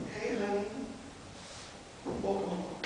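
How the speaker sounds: very soft, hushed greeting; tender welcome. Acted. Parent welcomes a baby home in a dream, tender. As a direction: speak gently, as if to a baby just caught.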